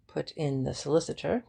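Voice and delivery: ordinary delivery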